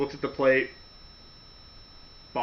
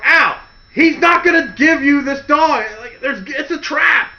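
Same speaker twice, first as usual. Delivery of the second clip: higher, louder urgent plea; urgent warning; 9 semitones higher, 4 dB louder than usual. Pretend. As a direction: shout a warning right at his ear. Warning Al beside him.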